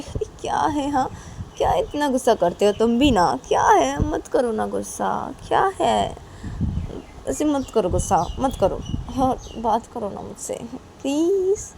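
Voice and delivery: high, whining appeal; exasperated pleading. Acted. Friend begs Nisha to talk to her, pleading. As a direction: beg her to stop sulking, pitch lifted.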